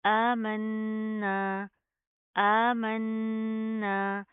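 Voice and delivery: आमन्ना with the noon held long through the nose; nasal ghunna. Shown as a demonstration. Teacher models ghunna in a slow recitation. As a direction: hum the double noon of आमन्ना slowly. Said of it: "गुना करेंगे".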